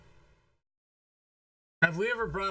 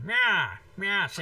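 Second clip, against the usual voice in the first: Edward G. Robinson voice; 3 semitones higher, 5 dB louder, much slower than usual. Acted. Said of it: "dinosaur sounds like Edward G. Robinson".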